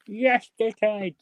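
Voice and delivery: high, drawn-out Hull catchphrase; imitated Hull accent. Fan echoing the character's greeting, imitated Hull accent. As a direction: stretch it out like a Hull lad. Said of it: "taken the accent and made my own character".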